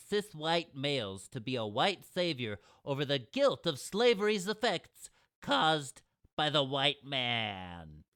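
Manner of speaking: a know-it-all nerd holding forth. Acted. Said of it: "gotta say it with the nerd voice".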